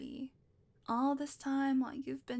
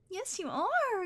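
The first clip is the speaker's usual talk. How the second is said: put-on much higher, bright baby-talk "Yes, you are"; sing-song praise pitched way up; high coo; 8 semitones higher, 3 dB louder, slower than usual. Repeated praise to the kitty.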